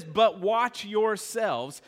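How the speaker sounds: very slow, weighty but watch yourselves; slow emphatic verse. Acted. Pastor drives home the verse to the church. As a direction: drag out the warning. Repeats key verse slowly.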